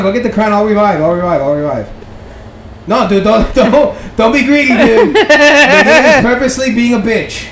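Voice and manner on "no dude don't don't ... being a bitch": warning through laughter; laughing. Real, not acted. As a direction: scold while cracking up.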